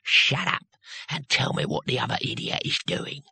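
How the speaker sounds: a hint of a squelchy old threat; menacing whisper at the ear; damp voice. Captor threatens at knifepoint.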